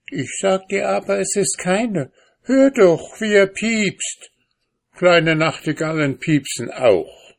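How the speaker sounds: sehr hohe Frauenstimme, piepsig spottend; streitende Ehefrau. A narrator's reading. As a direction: ihn stur zurechtweisen. Frau im Streit. (Ehefrau widerspricht dem Mann schrill im Zank)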